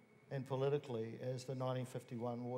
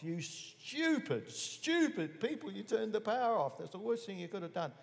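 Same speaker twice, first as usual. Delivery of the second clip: retold angry shout; 8 semitones higher than usual. Organiser yelling at police who cut the power, high exasperated retold shout. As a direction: scold them like idiots who wrecked everything. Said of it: "I'm shouting at these cops".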